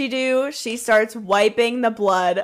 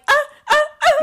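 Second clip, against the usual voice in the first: very high screechy ahs; mock screaming; 4 dB louder, much faster than usual. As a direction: screech helplessly. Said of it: "he's just like".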